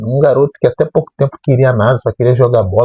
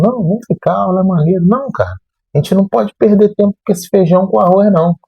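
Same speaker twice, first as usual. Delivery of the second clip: lightly acted higher-pitched back-and-forth; mock disagreement; 7 semitones higher than usual. Veteran classmates debating whether to stay in class, lightly imitated objection. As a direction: imitate two students arguing over the lesson.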